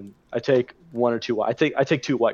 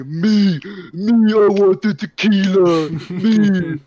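put-on higher, louder, slow drunken pleading; eager begging; 7 semitones higher, 6 dB louder, much slower than usual. Drunk guy begging the roommate for tequila, eagerly. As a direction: beg for the bottle like a kid.

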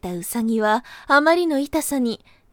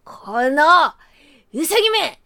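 put-on angry old man; 4 semitones higher, 3 dB louder than usual. Grandpa chasing with hatchet.

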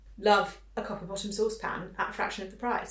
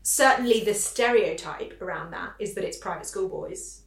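ordinary delivery

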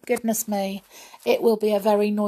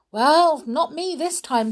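put-on high, lively girl's voice; little Goldilocks protesting; 9 semitones higher, 6 dB louder than usual. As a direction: pipe up in your own defence. Plays Goldilocks defending herself.

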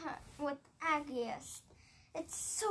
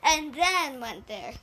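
usual speaking voice

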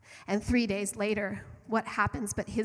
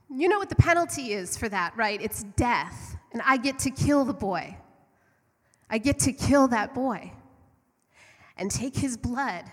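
gloating witch; 3 semitones higher, 6 dB louder than usual. Acted. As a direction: relish the threat like a cruel queen. Witch claims the boy.

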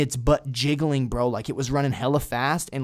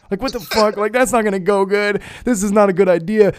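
retold panic; 7 semitones higher, 6 dB louder than usual. Retold panic facing the bear.

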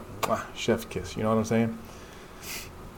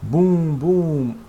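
higher, louder booming effect; dramatic booms; 6 semitones higher, 3 dB louder than usual. Acted. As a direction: hit the booms hard. Sound effect read dramatically.

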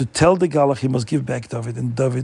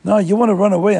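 loud, high accusing shout; angry yell; 7 semitones higher, 5 dB louder, faster than usual. Acted. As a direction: bark the accusation at him, higher and louder. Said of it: "the Poritz yelled at him".

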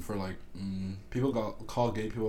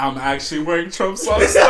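loud, higher, breaking into laughter; laughing confession; 5 semitones higher, 11 dB louder than usual. Real, not acted. Guest admitting Trump socks to host, laughing. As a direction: say it while cracking up. Guest cracking up at own joke.

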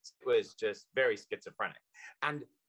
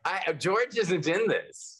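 playful protest; 9 semitones higher, 7 dB louder, slower than usual. Real, not acted. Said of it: "teasing reply, loud high".

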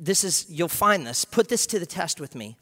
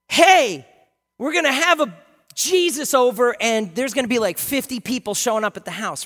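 high loud excited call; excited announcement; 6 semitones higher, 10 dB louder than usual. Retold or imitated. Martha hearing fifty guests are coming, excited high call. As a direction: burst in with big news. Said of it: "it's like".

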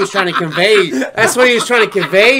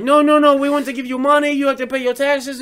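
voiced caller; 9 semitones higher than usual. Voicing the scam caller.

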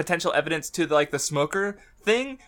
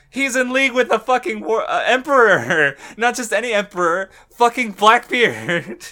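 real excitement; 6 semitones higher, 6 dB louder than usual. Real, not acted. Fan revealing Aokiji's new alliance, loudly amazed. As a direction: hammer home the huge reveal, louder and higher.

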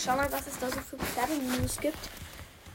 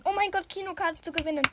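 higher, quicker burst of excitement; excited outburst; 5 semitones higher, faster than usual. Real, not acted. Podcaster spots cinema tickets in the horse news, excited. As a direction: blurt it out fast and high, thrilled by the prize. Reacts to a prize she reads out.

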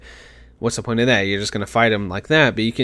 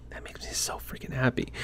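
breathless whispered delight; excited whisper; 12 dB quieter than usual. Real, not acted. Gamer whispering her joy over gorgeous graphics. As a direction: whisper it like a happy secret. Gushing about the game's graphics.

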